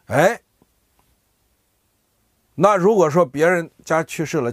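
ordinary delivery

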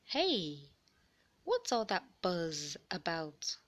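ordinary delivery, nothing marked

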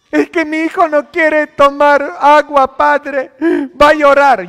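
high, loud mocking whine of a parent; whiny parent excuse. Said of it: "mocking a parent's excuse". (imitando a una madre quejumbrosa, aguda y fuerte)

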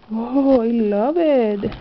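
normal talking voice

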